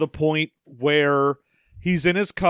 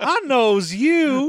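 put-on old prospector; 7 semitones higher, much slower than usual. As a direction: drawl like an old gold miner. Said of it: "he talks like an old prospector".

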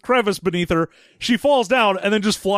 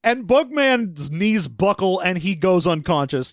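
ordinary delivery

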